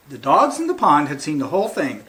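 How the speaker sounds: ordinary delivery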